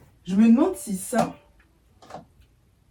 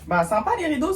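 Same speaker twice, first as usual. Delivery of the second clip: higher, sugary mock compliment to hide disappointment; fake polite praise; 7 semitones higher than usual. Pretending to thank her dad for curtains, mock-polite. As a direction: pretend to love a gift you secretly dislike. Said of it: "Je pouvais pas dire".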